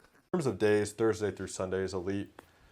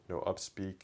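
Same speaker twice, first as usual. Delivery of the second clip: normal talking voice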